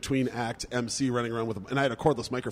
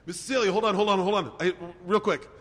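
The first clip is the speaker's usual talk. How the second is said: high, louder hurried plea; urgent calling out; 8 semitones higher, 3 dB louder than usual. Retold or imitated. Friend running over to stop the golf cart, urgent call. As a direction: call out to halt someone.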